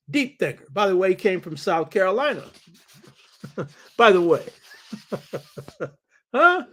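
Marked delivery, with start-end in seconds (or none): 4.9-5.9 low, soft chuckle. Real, not acted. Lecturer amused by his own aside, soft chuckling. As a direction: laugh quietly to yourself.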